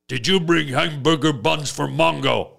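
deadpan brute asking a dumb question; deadpan caveman Mongo asking about buns; caveman talk. Retold or imitated. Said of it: "he still talks like this".

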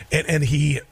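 normal talking voice

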